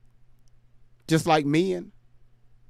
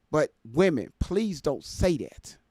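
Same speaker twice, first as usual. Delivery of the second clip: usual speaking voice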